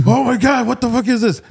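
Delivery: high, astonished outburst; amazed. Retold or imitated. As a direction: act out the shock of discovery. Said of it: "and I was like".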